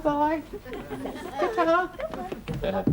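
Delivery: put-on high sing-song goodbye to a baby; baby talk. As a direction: coo it high to the little one.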